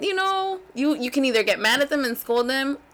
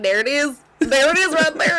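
raised, louder retold exclamation; triumphant gotcha; 6 semitones higher, 6 dB louder than usual. Mother catching daughter's smirk, triumphant gotcha. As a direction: pounce on it, getting louder.

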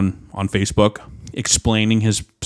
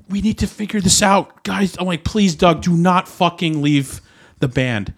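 imitated plea, pitched up and dragging at the end; retold emotional pleading; 7 semitones higher, slower than usual. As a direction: retell begging a friend to stay. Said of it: "I was just very emotional".

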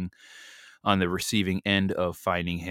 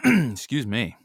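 throat clearing; 5 semitones higher, 7 dB louder, slower than usual. Real, not acted. Clears throat mid-sermon.

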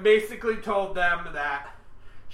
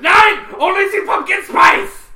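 high, loud mock German shout; German accent shout; 11 semitones higher, 14 dB louder than usual. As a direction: bark it like a cartoon German officer.

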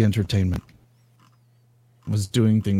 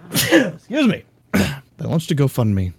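after sneezing; 4 semitones higher than usual. Real, not acted. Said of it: "I'm gonna sneeze".

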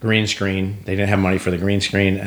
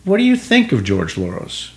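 loaded bait question; 6 semitones higher than usual. Retold or imitated. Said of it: "it was a bait question".

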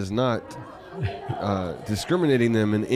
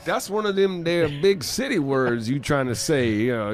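mock Southern yokel; 2 semitones higher, 4 dB louder than usual. Mock Southern reply to reader.

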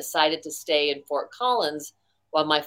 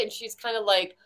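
ordinary talk, nothing marked

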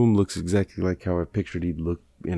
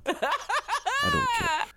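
laughing; 3 dB quieter, much slower than usual. Real, not acted. Laughing at himself.

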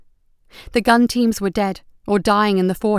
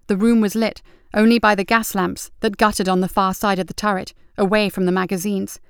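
plain reading voice

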